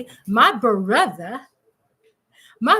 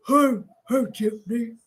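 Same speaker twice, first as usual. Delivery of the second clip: mimicking husband; 3 semitones lower than usual. Asking babe if he said it so, restrained imitation. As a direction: try out his hello in a put-on voice. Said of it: "You say it like that, babe?".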